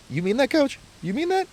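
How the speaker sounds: high, squeaky can't-believe-it voice; squeaky disbelief. Retold or imitated. Kit man asks the coach, in a squeaky high voice. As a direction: squeak it out like a thrilled kid. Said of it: "And then he's just like".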